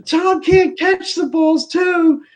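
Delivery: high, slow, mocking fan put-down; mock Giselle rant. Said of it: "she goes off on them".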